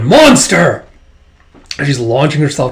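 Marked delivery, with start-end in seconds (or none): 0.0-0.9 shouted accusation, a touch higher; monster roar. Acted. Wolf Queen charging at Zin, a loud shout. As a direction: bellow it at him. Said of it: "uh, going".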